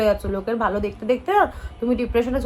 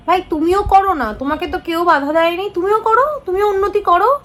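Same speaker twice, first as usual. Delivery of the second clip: louder, higher, telling them off; exasperated retort; 6 semitones higher, 4 dB louder than usual. Real, not acted. Vlogger snapping back at a jealous commenter, exasperated and loud. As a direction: push back hard at the critic.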